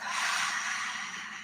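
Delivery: long soft breathy exhale out the mouth; quiet release sigh; relaxed sigh. Shown as a demonstration. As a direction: let the breath go in a slow audible sigh. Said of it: "slow and relaxed breath out through the mouth".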